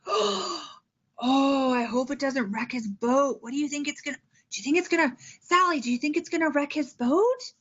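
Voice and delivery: mildly acted concern for a story character; teacher engaging kids. Shown as a demonstration. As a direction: put the question to a young pupil. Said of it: "Talk about".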